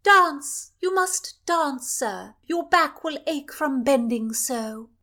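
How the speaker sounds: high, loud royal order; the princess ordering the chaplain to dance, high and loud; commanding princess. Acted. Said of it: "Cecilia commanded in English".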